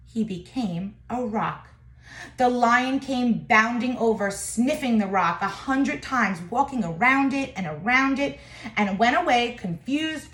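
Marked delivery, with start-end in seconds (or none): none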